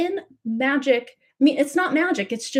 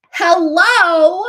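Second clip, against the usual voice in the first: loud, high, rising hello of disbelief; stunned hello; 7 semitones higher, 10 dB louder than usual. Real, not acted. Reader reacting to a striking card, loud astonished hello. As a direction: call out in amazed disbelief.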